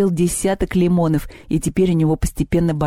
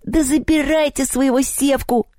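high, exasperated goblin cry; wailing capitulation in a goblin voice; goblin giving in; 10 semitones higher than usual. A narrator's reading. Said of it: "сдался домовой".

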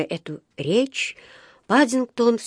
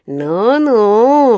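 mocking, drawn-out scoff, louder at the end; с усмешкой; 6 dB louder, much slower than usual. A narrator's reading. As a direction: scoff with a hint of a chuckle. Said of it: "хохотнул вахтёр". (насмешливо, нараспев)

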